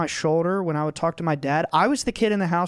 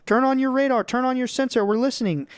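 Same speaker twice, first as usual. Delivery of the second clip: mocking sci-fi voice; 7 semitones higher than usual. Dad mocking the materialistic view, in a higher, mock sci-fi voice. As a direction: send it up like a cheesy radio operator. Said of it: "he's talking like this".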